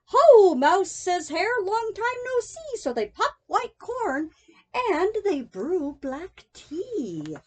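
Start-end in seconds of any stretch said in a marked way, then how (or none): 0.0-2.8 hearty loud greeting. Acted.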